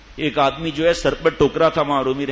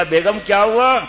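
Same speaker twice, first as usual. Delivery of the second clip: delighted, raised and louder; husband happily calls his wife, raised voice; happy call; 5 semitones higher, 6 dB louder, slower than usual. Retold or imitated. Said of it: "خوش ہو کر کہا".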